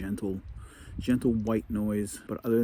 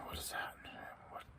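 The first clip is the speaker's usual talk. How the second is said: whisper; 14 dB quieter than usual. Retold or imitated. Startled night noises in tent.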